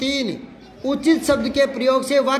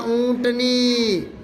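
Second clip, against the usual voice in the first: drawn-out dictation; much slower than usual. Shown as a demonstration. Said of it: "ऊंट का, हाँ".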